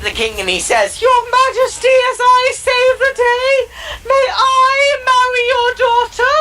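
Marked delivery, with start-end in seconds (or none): 1.0-1.9 very high, whiny troll voice; very high, louder pleading troll; squeaky troll. Acted. Third troll addresses king. 2.1-6.3 very high, slow whiny squeak; high, drawn-out cartoon-troll plea; squeaky troll pleading. Third troll asks for hand.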